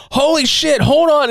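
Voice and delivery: put-on very high, urgent kid's outburst; imitated sudden panic. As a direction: blurt it like a kid snapping awake. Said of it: "comes out of his trance".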